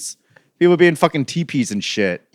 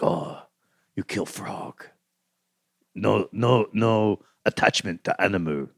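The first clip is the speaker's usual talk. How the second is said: low, quiet broken-English voice; lightly voiced villager; mock foreign accent; 6 semitones lower, 10 dB quieter, slower than usual. Mocking villager friend quote.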